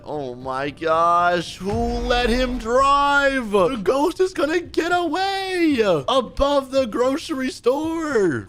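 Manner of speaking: drawn-out, high-pitched wail of frustration; hammed-up outrage; exasperated yelling. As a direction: stretch every word into a panicked holler. Yelling at a bad driver.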